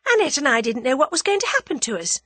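high, quick lively woman's voice; animated girl. A narrator's reading. Tuppence recounts their fright in the car, animated. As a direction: tell it in a quick high rush. Tuppence tells of her ordeal.

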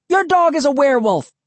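high, loud teasing call. A narrator's reading. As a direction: call it from the other room. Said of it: "Emily called".

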